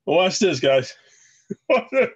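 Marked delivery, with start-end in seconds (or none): 1.5-2.1 breaking into a high laugh while talking. Real, not acted. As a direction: try to say it through a real laugh. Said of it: "I shouldn't laugh".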